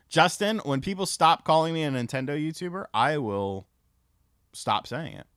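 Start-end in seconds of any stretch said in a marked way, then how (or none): none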